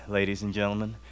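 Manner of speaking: ordinary talk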